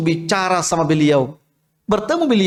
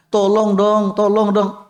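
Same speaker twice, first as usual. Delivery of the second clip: whining plea; 4 semitones higher, slower than usual. Retold or imitated. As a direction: wheedle like a child begging for a treat. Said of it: "Merengek-rengek". (merengek-rengek memohon)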